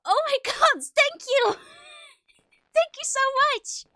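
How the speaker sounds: high, loud thrilled thanks; excited thanks. Real, not acted. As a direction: gush thanks, overwhelmed. Thanking for ten gift subs.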